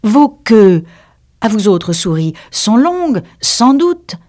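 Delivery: high-pitched, puzzled Alice; astonished. A narrator's reading. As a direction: wonder aloud, pitch climbing. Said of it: "avec étonnement".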